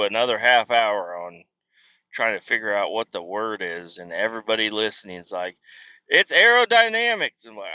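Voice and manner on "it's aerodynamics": mock know-it-all listeners. Imagined listeners correcting him.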